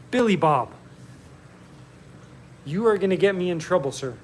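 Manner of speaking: playful scold, high and quick; mock scolding. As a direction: tease him like a stern teacher. Mock scolding a chat viewer.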